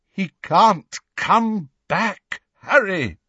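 high, slow, halting and strained; voice breaking. A narrator's reading. Lupin restraining Harry from following Sirius, voice breaking. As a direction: struggle to get each word out. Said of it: "his voice breaking".

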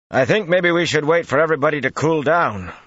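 high, loud, sustained holler; calling out. A narrator's reading. Flynn urges waiting from behind cover, calling loudly. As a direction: project each word across a distance. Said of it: "Flynn's voice called".

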